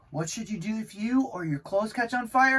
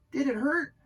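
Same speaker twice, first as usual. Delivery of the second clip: cat friend asking; 8 semitones higher than usual. A narrator's reading. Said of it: "Carlos the cat asked".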